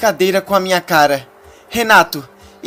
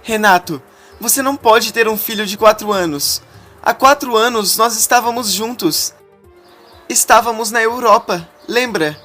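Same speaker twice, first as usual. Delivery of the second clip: high, stunned pleading; rising in pitch as the shock sinks in; woman in shock confronting her fiancé, pitched high; 9 semitones higher than usual. A narrator's reading. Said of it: "Em choque".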